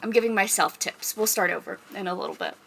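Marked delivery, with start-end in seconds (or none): none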